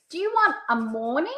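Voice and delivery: "morning" swung up at the end; pitch up on first option. Shown as a demonstration. Consultant offers Amy a first option, pitch rising. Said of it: "listen to the tone and the pitch".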